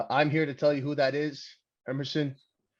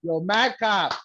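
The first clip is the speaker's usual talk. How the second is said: mock yell; 6 semitones higher, slower than usual. Real, not acted. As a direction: heckle him like a rowdy fan. Yelling insults at wrestler on screen.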